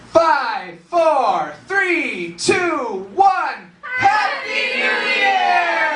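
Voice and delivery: high, drawn-out party countdown building to a shout; New Year's yell. Shown as a demonstration. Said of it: "everyone's gonna yell with me".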